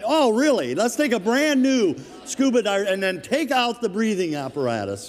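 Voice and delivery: high, loud sarcastic disbelief; mock incredulous. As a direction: exclaim it like the plan is absurd. Mocks the risky scuba wedding plan.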